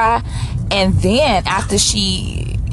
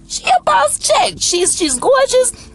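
hyped praise; slower than usual. Real, not acted. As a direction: gush about her like a fan. Hyping up Shay as boss chick.

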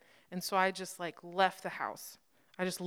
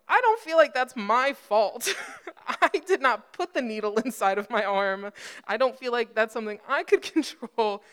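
loud, higher, hammed-up defensiveness; mock indignant protest; 4 semitones higher, 10 dB louder than usual. Injured patient arguing with paramedic over blood on pants, mock indignant. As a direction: protest your innocence loudly for laughs.

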